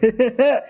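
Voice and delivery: a short pleased laugh at himself; smug laugh. Acted. Uncle flattered by his niece, a short smug laugh. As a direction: chuckle like you just got praised.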